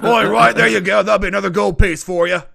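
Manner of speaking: a loud, gleeful barkeep charging extra. Acted.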